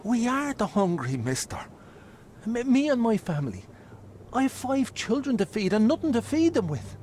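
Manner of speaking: high, pleading beggar voice; desperate pleading. Acted. Starving father begging the hero for his sack. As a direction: beg for the food with rising pitch.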